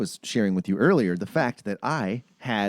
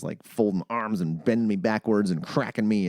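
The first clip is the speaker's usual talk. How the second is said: ordinary delivery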